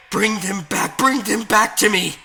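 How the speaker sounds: high, very loud demand; desperate shout. Acted. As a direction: yell at the game, frantic. Desperate detective shouts at game.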